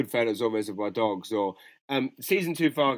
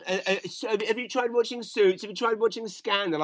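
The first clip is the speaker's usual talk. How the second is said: higher-pitched mock of a pushy fan; lightly hammed-up eager recommender; 10 semitones higher than usual. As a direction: send up people suggesting shows. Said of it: "people are like".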